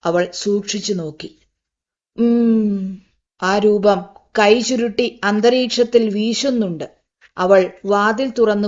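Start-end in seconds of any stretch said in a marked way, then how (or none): 2.2-3.0 long hum. A narrator's reading. The figure humming outside.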